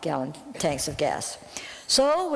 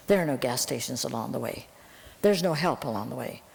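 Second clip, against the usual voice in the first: ordinary talk, nothing marked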